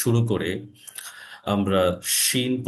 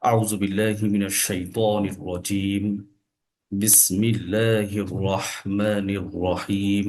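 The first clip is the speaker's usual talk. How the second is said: slow, measured Quranic recitation; low, solemn opening recitation in Arabic; Arabic invocation; 4 semitones lower, much slower than usual. Real, not acted.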